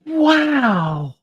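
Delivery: high, chirpy sing-song wow; chirrupy sing-song exclamation. Acted. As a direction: chirp it up like an excited little voice. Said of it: "exclaims a chirrupy sing-song voice".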